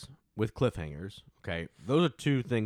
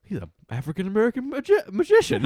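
high, loud, far-from-usual mimic voice; imitated film line; 11 semitones higher, 5 dB louder than usual. Retelling a funny film scene to co-hosts, high mock voice. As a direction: climb higher and louder into the last word. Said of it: "He's like".

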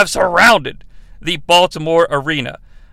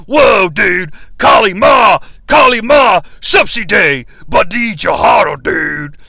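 loud raspy chanting wrestler impression; Hulk Hogan voice; 5 dB louder than usual. Reviewer acting out Hogan's temple ritual, shouting the chant. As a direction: bellow the chant like a hammy wrestling star. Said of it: "in the Hulk Hogan voice".

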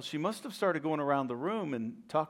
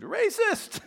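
screamed accusation; 4 dB louder than usual. Pretend. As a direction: shriek it as an accusation. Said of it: "She would have jumped up and screamed".

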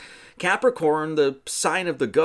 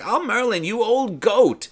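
woman scolding; 8 semitones higher, 4 dB louder, slower than usual. Retold or imitated. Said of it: "this woman comes in and she's like".

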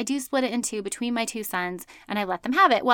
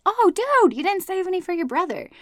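mock shocked mom; 8 semitones higher than usual. Mom catching her son who downed the milkshake, high mock shock. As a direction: gasp it out like you just noticed the empty cup. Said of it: "I turned around and I'm like".

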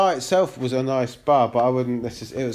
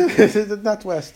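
put-on Israeli accent; 5 semitones higher, 5 dB louder than usual. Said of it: "You do an Israeli accent".

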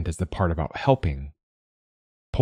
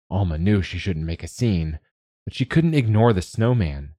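usual speaking voice

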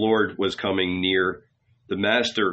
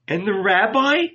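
high, pleading rising question; heartrending question; 9 semitones higher than usual. A narrator's reading. Magdalene asking disciples after the rabbi, heartrending. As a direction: plead for news, pitched up. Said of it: "she asked in a heartrending voice".

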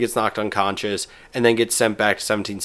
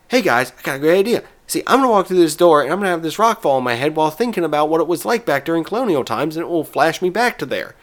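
put-on fast, higher, pushy kid voice; a half-baked bratty cartoon kid; hammed-up cartoon boy, quick and high; terrible Cartman impression; 5 semitones higher, 3 dB louder, faster than usual. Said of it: "this is my very terrible Cartman impression".

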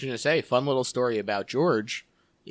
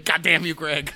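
mock outrage; 7 semitones higher, 8 dB louder than usual. Rival takes a wanted pick.

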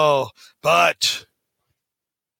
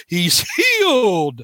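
slow, drawn-out preacher's proclamation, higher; old time preacher; 5 semitones higher, much slower than usual. Retold or imitated. Retelling John's healing, hammed-up old time preacher. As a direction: proclaim it like a tent revival healer. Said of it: "he goes like a preacher".

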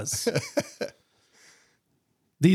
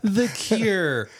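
laughing; 5 semitones higher than usual. Real, not acted. Laughing naming joke.

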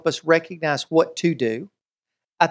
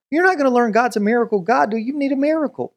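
normal talking voice

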